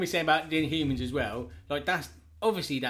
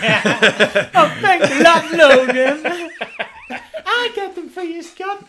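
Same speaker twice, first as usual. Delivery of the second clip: much higher, louder, sing-song mock woman's voice; mock Jean Grey; 14 dB louder, much slower than usual. Podcaster plays Jean fetching Scott's glasses, high mock gushing. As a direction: gush it like a fawning heroine. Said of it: "That's Gene doing that".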